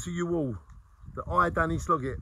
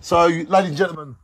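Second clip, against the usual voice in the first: loud; 10 dB louder than usual. Real, not acted. Announcing to audience.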